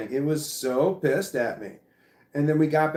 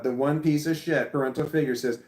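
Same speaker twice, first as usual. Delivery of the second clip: ordinary talk, nothing marked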